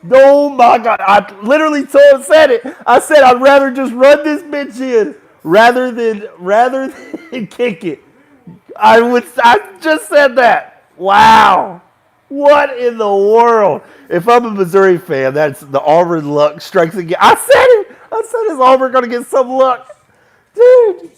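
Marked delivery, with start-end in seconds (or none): 0.1-13.8 high, loud, drawn-out disbelief; incredulous ranting. Real, not acted. Ranting after missed field goal. 17.2-21.1 high, loud, laughing gloat; I-told-you-so yell. Real, not acted. Gloating that he predicted it.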